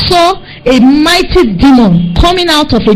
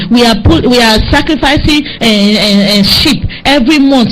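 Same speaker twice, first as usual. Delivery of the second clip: normal talking voice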